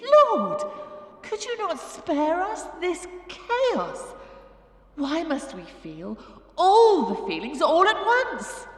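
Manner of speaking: high, impassioned plea to heaven; hammed-up desperate prayer; pleading. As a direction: beg the Lord, swelling at the end. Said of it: "And the women pleaded".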